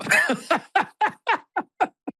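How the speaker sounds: a long high laugh; high laughter. Real, not acted. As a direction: laugh along, amused. Laughs at retold quote.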